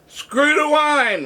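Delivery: high, stretched answering holler; retold group reply. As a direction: imitate the room chanting right back. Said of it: "everyone in the place would turn around and say".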